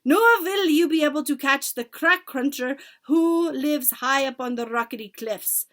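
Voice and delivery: lofty witch pronouncement; imperious witch. A narrator's reading. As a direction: lecture them grandly. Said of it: "the Grand High Witch went on".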